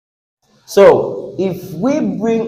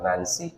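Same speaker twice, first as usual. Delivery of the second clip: "Nancy" said low and soft as nan-SEE; low, offhand nan-SEE for "Nancy"; village-style stress; 12 semitones lower, 16 dB quieter than usual. Retold or imitated. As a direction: quietly mimic an end-stressed "Nancy". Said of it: "coming from one village like that".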